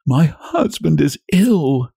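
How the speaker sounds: high, slow understated sob; grief-stricken wife, mildly acted; sobbed out. A narrator's reading. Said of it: "she sobbed out".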